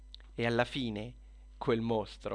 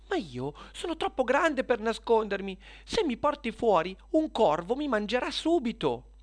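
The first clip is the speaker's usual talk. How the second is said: worried spider; 11 semitones higher, 4 dB louder than usual. Acted. Spider fears the crow.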